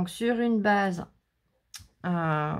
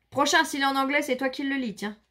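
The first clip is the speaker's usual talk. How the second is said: raised, higher voice of playful indignation; mock scolding; 5 semitones higher, 3 dB louder than usual. Real, not acted. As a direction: snap back loudly at someone teasing you. Mock scolding of teasing companion.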